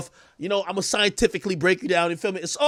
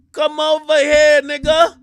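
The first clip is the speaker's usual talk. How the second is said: slow, louder come over here; calling out; 5 semitones higher, 5 dB louder, slower than usual. Real, not acted. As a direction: summon him across the room. Calling out to Sharpie.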